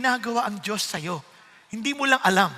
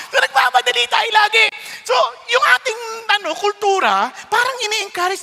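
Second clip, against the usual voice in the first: higher, louder preaching shout; 9 semitones higher, 4 dB louder than usual. Real, not acted. Pastor exhorting the congregation about haste, loud and high. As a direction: drive the point home from the pulpit.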